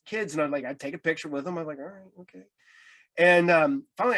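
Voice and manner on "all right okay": very soft, reluctant giving-in; resigned agreement. Retold or imitated. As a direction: mumble it, barely audible, going along. Giving in to yet another photo.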